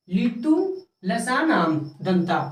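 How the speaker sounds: slow, separated words, dropping low on the last; measured rule recitation. Shown as a demonstration. Teacher recites the Sanskrit rule.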